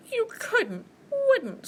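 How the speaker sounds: very high frightened squeak; squeaking mouse. Acted. Mouse threatened with being eaten, squeaking. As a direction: squeak it out haltingly in alarm. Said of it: "squeaked the mouse".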